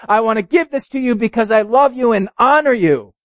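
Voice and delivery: high, loud, animated preacher storytelling; preaching at full force. Acted. Preacher retelling the farmer's gift, high and loud. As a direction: build the excitement as he tells the farmer's gift.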